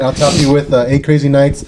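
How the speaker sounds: usual speaking voice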